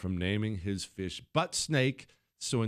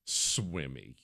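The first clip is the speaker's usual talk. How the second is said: low, quiet deadpan repeat; low deadpan echo; 10 semitones lower, 6 dB quieter than usual. Real, not acted. Father repeating the son's fake name, low and quiet. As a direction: drop it flat as the punchline. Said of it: "repeated punchline".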